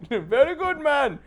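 very high mock Indian accent; Babu Bhatt impression. Babu Bhatt scolding, very high mock Indian accent. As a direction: echo Babu's indignant line. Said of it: "Babu Bhatt".

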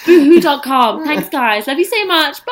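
bubbly vlog sign-off; YouTuber voice. Pretend. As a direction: wrap up like an influencer outro. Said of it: "Have a great YouTuber voice".

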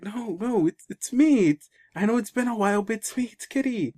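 high, desperate reassurance; pleading voice. Retold or imitated. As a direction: insist it's really him, voice up high. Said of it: "She's crying and goes like".

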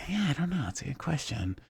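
unvoiced puzzled whisper. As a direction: whisper it like a confused kid. Kids puzzled at playground.

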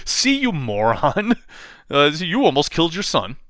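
slow, high jeer, lightly acted; playful mock scolding; mock gloating. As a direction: rub it in, drawing out the words.

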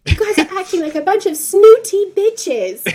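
the film's bad English accent. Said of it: "but in this, like, really".

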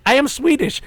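high mock Swedish, AM stressed; hammed-up fake Swedish accent leaning on AM; bad Swedish accent. A fake Swede telling the crowd in a bad Swedish accent. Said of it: "his accent was so bad".

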